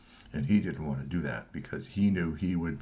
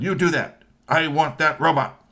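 curt boss; 8 semitones higher, 7 dB louder than usual. Acted. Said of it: "Kusamono-san".

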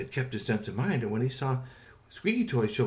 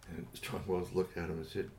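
ordinary delivery, nothing marked

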